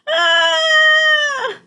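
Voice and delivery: high, loud panicked shriek. A narrator's reading. Bird falling in the dark, loud high shriek. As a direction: scream as you fall in the dark. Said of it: "shrieked Flap".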